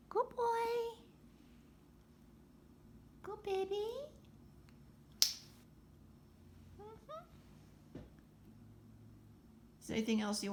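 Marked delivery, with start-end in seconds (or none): 0.1-8.0 high sing-song coo for the parrot. Real, not acted. Owner cooing at her parrot Jasper, high sweet baby talk.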